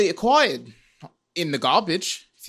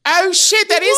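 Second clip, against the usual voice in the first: sudden realisation; 12 semitones higher, 6 dB louder than usual. Real, not acted. Realises stolen bit.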